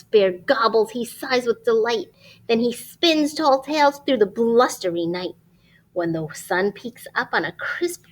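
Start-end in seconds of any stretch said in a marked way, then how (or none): none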